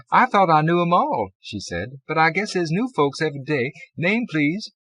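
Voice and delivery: easygoing woman at the desk; chatty clerk voice. A narrator's reading.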